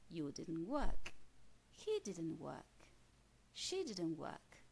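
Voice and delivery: each example said slowly and deliberately; slow model sentences. Shown as a demonstration. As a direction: drill the pattern slowly for the class. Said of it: "Y fíjense también aquí qué ocurre". (ejemplos lentos y marcados)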